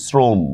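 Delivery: "শ্রম" said srom, with a plain s; dental s pronunciation. Shown as a demonstration. Teacher answering how to pronounce it, "শ্রম" as srom. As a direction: start "শ্রম" on a dental s, not sh. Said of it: "এটার উচ্চারণ কিভাবে হবে? এইভাবে".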